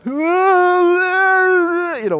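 put-on a child wailing after the fall; mock crying wail. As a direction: wail like a hurt kid. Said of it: "crying kind of things".

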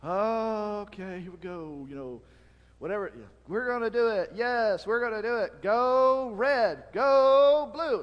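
loud, high pep-rally cheering; routine cheer. Retold or imitated. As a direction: cheer like a crowd going through the motions. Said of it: "whatever the cheer".

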